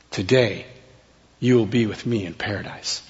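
soft, low reassurance; tender promise. Retold or imitated. Jesus promising the dying thief paradise, softly reassuring. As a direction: say it low and gently, pausing after the first word.

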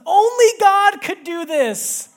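very high, loud and slow, full of wonder; awed daughter. Retold or imitated. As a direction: echo the little girl's amazed cry. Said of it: "out of her mouth just comes".

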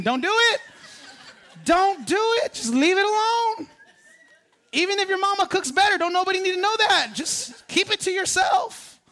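high-pitched comic plea, half laughing; high laughing warning. Acted. Preacher warning husbands about mama's cooking, high and comic. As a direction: warn them off, voice up high.

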